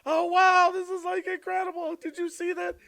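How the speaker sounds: very high, amazed mock squeal; freaking out. City visitor amazed by cows running, freaking out. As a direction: gush in an over-excited high voice. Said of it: "I'm freaking out like".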